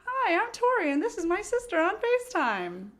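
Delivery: high, bright-eyed audition greeting; sugary high self-introduction; mock audition voice. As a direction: chirp it up like a nervous audition. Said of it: "So I was like".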